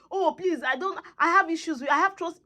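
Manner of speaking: high-pitched mock excuse-making; mock reluctant pleading; mimicked excuses. Mimics excuses he never made.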